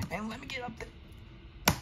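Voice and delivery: high, soft, drawn-out whine; frustrated whine. Real, not acted. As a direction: squeeze it out slowly, high and small. Frustrated at being blocked in game.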